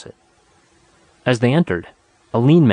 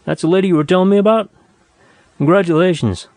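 quick, higher-pitched friendly surprise; lively, raised congratulating tone; exclaiming; 7 semitones higher, 3 dB louder than usual. Acted. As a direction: greet an old pal eagerly. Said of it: "he cried".

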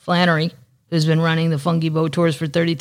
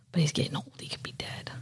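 whisper; 13 dB quieter than usual. Real, not acted. Fearing the dolphin is dead.

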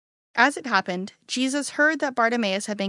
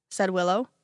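ordinary delivery

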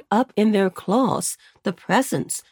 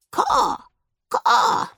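raspy cawing crow; 11 semitones higher than usual. Acted. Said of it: "said the head crow".

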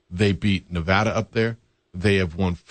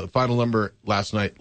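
ordinary talk

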